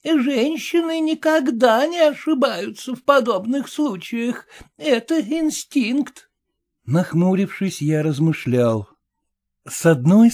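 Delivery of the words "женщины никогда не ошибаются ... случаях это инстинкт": put-on high, grand old dame address; stately old lady. A narrator's reading. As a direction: address him with lofty dignity. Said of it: "величаво сказала старуха".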